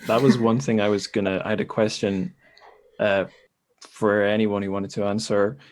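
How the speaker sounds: ordinary talk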